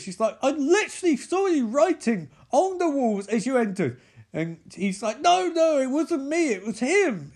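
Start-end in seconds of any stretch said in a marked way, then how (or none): 0.4-4.0 high-pitched indignant retelling; mock accusation. Acted accusation in retold quarrel. 5.2-7.3 high, loud panicked denial; hammed-up high-voiced denial; mock protest. Acted denial in retold quarrel.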